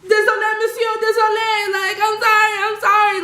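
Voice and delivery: high, pleading singsong sorry; frantic apology. Retold or imitated. Apologizing to an angry bouncer, high pleading voice. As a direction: beg forgiveness in a panicky rush. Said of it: "So I was like".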